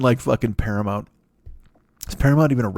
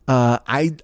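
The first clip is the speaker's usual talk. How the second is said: ordinary talk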